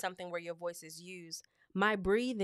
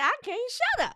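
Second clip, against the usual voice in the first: excited burst; 8 dB louder than usual. Real, not acted. Excited about new confidence.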